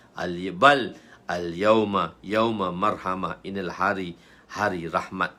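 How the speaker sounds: slow, measured, settled reply; merciful correction. Acted. Prophet replies it is a day of mercy, measured calm. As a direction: answer steadily with no battle-cry pitch.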